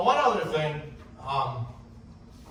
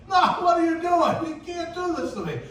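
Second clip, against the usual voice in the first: high, loud mock alarm; acted protest; 12 semitones higher, 5 dB louder than usual. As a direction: cry out in exaggerated dismay. Acts out his protest to Jim.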